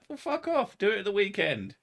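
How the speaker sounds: squeaky high dismissive retort; imitating a snippy brush-off to visitors; mock retort. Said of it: "I'm like".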